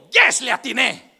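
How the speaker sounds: loud slow triumphant yes; gloating yes. Retold or imitated. Boy gloating over his winning insult, loud triumphant. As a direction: savor the win, loud and slow.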